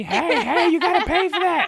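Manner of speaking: high, full-voiced accusing screech; screaming old lady. Imitating a store worker chasing a customer, screaming. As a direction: shriek it to stop someone leaving. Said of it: "at the top of her lungs".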